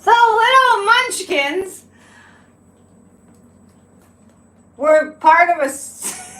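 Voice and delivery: high, loud and slowed, barely holding back laughter; squeaky amazed mockery, half laughing; incredulous laughing disbelief. Real, not acted. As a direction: pitch it up in giggly outrage at the absurdity. Mocking a ridiculous conspiracy claim.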